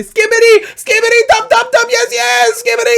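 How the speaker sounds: very high, loud nonsense chant; hammed-up shrieked gibberish prayer; frantic mock prayer. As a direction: yell it like a desperate plea. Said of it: "you pray to your false god".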